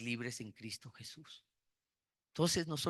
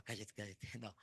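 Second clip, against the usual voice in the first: hushed scolding; 6 semitones lower, 14 dB quieter than usual. Retold or imitated. Wife hushing him in the story.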